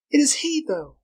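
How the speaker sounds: put-on very high start, emphatic girl's voice; insistent. A narrator's reading. Girl contradicting her companion about Tarzan, insistent. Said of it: "she insisted".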